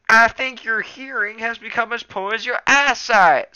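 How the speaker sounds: put-on loud, impatient old tom; impatient snap. A narrator's reading. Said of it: "snapped Small Ear impatiently".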